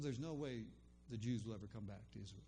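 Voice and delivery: normal talking voice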